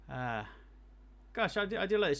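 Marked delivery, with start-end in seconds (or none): 1.3-2.2 delighted. Real, not acted. Delighted at the graphics.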